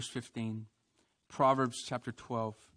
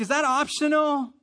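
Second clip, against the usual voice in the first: high, loud, innocent-sounding question; mock innocent question; 12 semitones higher, 7 dB louder than usual. Churchgoer asking God about a command, mock innocent high question. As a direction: ask it like a hopeful loophole seeker, pitched up. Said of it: "And we're like".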